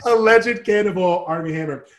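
amused, loud self-correction; laughing correction. Real, not acted. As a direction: laugh through the legal correction. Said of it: "We have to say alleged".

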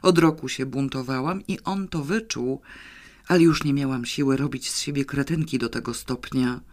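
plain reading voice

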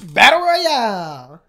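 drawn-out loud announcer call; slow announcer call. Acted. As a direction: stretch it like a ring announcer. Hyping the battle royale.

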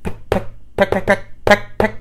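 high, quick pecking noises; pecking sounds. A narrator's reading. Bird pecking at the cookies. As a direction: peck out each word like a beak.

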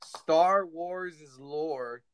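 Star Wars is lore said slowly and deliberately; carefully spelling out Star Wars is lore. Real, not acted. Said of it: "say that five times".

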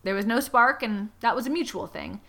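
usual speaking voice

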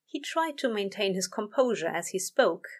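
ordinary delivery

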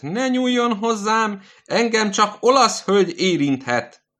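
high, loud thin-voiced scream of a sick old man; thin shrieking yell. Sick old man in bed yelling at the nurse, thin high shriek. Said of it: "Vékony sveszterrel üvöltött".

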